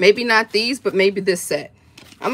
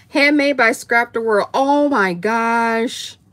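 ordinary talk, nothing marked

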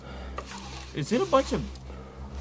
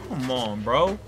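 ordinary talk, nothing marked

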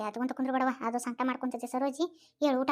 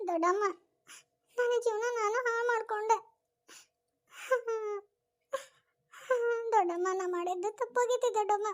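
higher, tearful lament; weeping; 7 semitones higher than usual. Acted. Niece weeping to her aunt over her ruined life, higher tearful lament. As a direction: wail over her own mistake. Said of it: "ಅಳಬೇಡವ".

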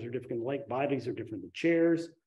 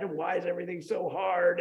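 mock frustrated complaint; 4 semitones higher than usual. Speaker retelling being late for a recovery meeting, mock frustrated. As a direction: imitate your own exasperated fretting.

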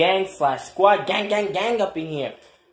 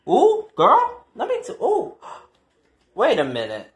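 ordinary talk, nothing marked

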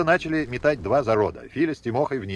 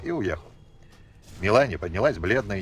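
ordinary talk

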